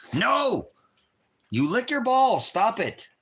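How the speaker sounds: high, loud playful squeal; mock scolding. Mock scolding dog's kisses.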